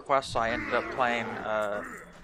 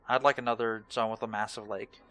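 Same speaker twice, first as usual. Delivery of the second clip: ordinary talk, nothing marked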